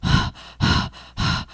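hard, repeated gasps for breath; imitated heavy breathing. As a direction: act out hyperventilating in shock. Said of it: "breathing really heavy".

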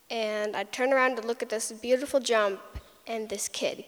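ordinary delivery

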